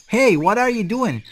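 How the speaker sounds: high-pitched startled objection; startled protest. Acted. Dragon being hugged unwillingly, startled protest. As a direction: protest the sudden hug, pitch jumping up.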